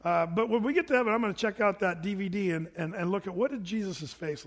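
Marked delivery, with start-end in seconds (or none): none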